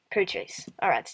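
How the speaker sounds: ordinary delivery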